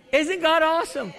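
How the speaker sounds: building preacher's call. Acted. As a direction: push it harder the second time.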